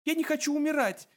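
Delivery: very high, frantic shout; high-pitched wail of protest; panicked cry. A narrator's reading. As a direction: cry out in terror, voice way up. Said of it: "закричал Артур".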